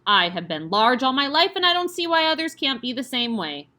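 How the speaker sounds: loud bullying headmistress. Acted. Headmistress booms her size at the children, loud and indignant. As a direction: boom it at the class.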